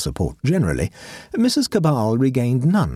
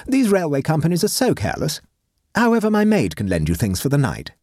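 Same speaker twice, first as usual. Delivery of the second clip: high, imperious society matron; haughty grand lady; 9 semitones higher than usual. Acted. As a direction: lecture like a pompous hostess. Voices Mrs Cabal.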